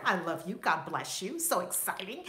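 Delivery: ordinary delivery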